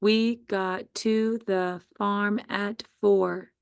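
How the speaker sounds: very slow, word by word; slow teacher dictation of the sentence. Shown as a demonstration. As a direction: dictate it slowly for spelling. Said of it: "Sentence number two".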